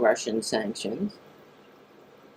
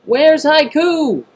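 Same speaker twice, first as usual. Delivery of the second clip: calling out; 11 semitones higher, 9 dB louder, slower than usual. Real, not acted. Calling out for a chat moderator.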